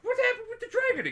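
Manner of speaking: squeaky question. Retold or imitated. Roach asks about the dragon.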